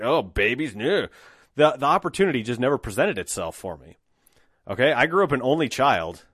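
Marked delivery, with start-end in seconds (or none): 0.0-1.1 sitcom father. Retold or imitated. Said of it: "sitcom father or something who's like".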